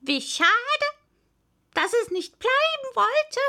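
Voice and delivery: loud, high parrot lament; high-pitched theatrical regret; sighing parrot. A narrator's reading. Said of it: "seufzte der Papagei".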